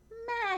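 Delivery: very high bleat; goat bleat. Acted. Goat joining the ride, a very high bleat. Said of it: "said Goat".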